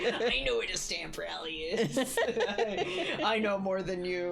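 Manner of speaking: laughing smug imitation; laughing mock boast. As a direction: laugh through a mock know-it-all boast.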